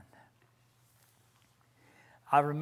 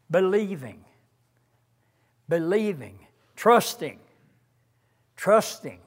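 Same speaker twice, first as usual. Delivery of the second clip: emphatic preaching; 3 semitones higher, 8 dB louder than usual. Real, not acted. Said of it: "preacher building intensity".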